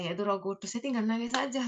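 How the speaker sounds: normal talking voice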